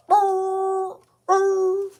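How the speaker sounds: high rolling trill of a hound on the scent; bay it out high like a coon dog; imitated hound baying, pitched high.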